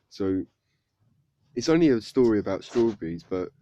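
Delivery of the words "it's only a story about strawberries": ordinary delivery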